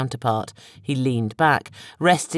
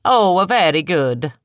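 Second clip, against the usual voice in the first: slow, louder mock-delighted chuckle through the words; chortling; 4 semitones higher, 6 dB louder, slower than usual. A narrator's reading. Barnes mocking Dave's failed ruse, chortling. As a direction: savour it like a smug villain. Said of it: "Barnes chortled".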